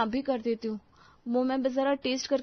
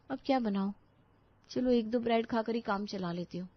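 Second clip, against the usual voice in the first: ordinary delivery, nothing marked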